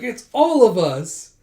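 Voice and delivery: high, loud, slow and sing-song; playful cheeky voice. Acted. Zeno teasing the others, high and slow. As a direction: drawl it out like a cheerful kid. Zeno cheerfully answers the quarrel.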